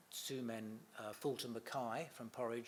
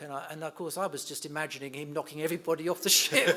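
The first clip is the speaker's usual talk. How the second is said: laughing; 5 semitones higher, 12 dB louder than usual. Real, not acted. Laughing while telling cruise story.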